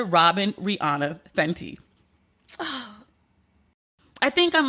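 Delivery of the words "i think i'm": slow, sighing through real tears; tearful sigh. Real, not acted. Host composing herself after tearing up, shaky sigh. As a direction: catch your breath and let it waver. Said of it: "I'm really sitting here crying".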